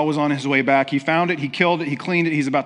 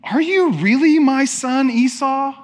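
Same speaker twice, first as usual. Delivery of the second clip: high, loud, incredulous questioning; acted-out suspicious father, voice raised; doubting question; 11 semitones higher, 7 dB louder than usual. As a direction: demand to know, pitched high and loud. Isaac acted doubting his son.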